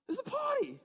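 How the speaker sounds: high-pitched outburst of disbelief; high, exasperated protest; incredulous exclamation. Acted. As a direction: exclaim it as if it were obvious, voice leaping up. Exasperated at silence at party.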